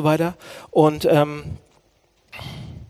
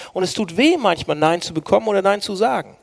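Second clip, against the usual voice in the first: ordinary talk, nothing marked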